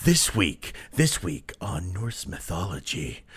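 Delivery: low, hushed mock TV announcer; soap announcer. Introducing this week on Norse mythology, mock-dramatic announcer. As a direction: intone it like a soap promo.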